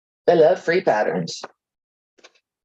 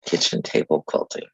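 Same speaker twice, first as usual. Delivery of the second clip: much lower, softer "Kitchen table quilting"; deep mock voice; 14 semitones lower, 4 dB quieter than usual. Real, not acted. Reminding herself of the name in a deep murmur.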